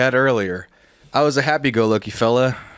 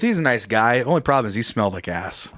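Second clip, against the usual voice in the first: normal talking voice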